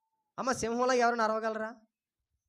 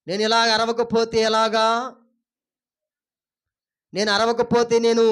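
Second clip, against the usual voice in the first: lightly voiced boastful lion; loud, self-important protest; hint of a lordly beast, louder; proud lion; 7 dB louder than usual. Acts the proud lion speaking.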